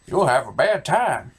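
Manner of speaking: ordinary talk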